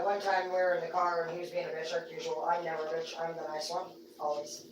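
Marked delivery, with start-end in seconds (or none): 2.5-4.7 softer, dry sarcastic aside; sly aside. Acted. Talking about fights with Zeke, softer sly aside. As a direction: say it under your breath, mock innocent. Ironic self-defence aside.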